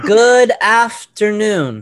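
high, loud, very drawn-out syllables; kid reading aloud. Retold or imitated. Teacher mimicking pupils reading, slow loud word-by-word chant. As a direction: imitate a second grader sounding out each word. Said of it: "That's how my kids read".

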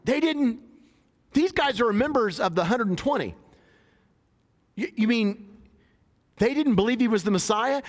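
high, incredulous questioning; mock shocked listener. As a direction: voice up in disbelief. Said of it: "You're like".

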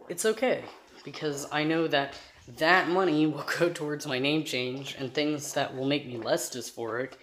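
ordinary talk

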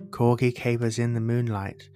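plain reading voice

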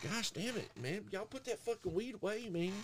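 put-on a hushed high scold to the kids smoking; exasperated scolding. Scolding the potheads.

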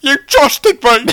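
high, slow, mock-tearful Cockney wail; Michael Caine. Butler wailing to his master, mock-tearful Cockney impression. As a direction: ham up a crying old butler. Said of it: "Just cry and look gross".

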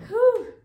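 high loud relieved exhale; loud whew. Real, not acted. After the story, loud high whew. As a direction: blow out hard.

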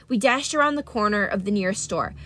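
usual speaking voice

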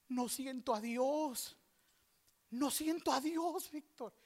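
slow, high, pleading wail; anguished lament. Retold or imitated. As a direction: imitate a distraught woman's drawn-out lament. Acts friend's anguished lament. (lamento angustiado, lento y agudo)

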